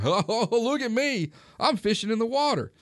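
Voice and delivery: joking show-off. Retold or imitated. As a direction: imitate a goofball calling to his friends. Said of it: "he's joking with his friends".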